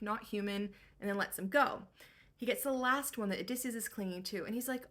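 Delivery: normal talking voice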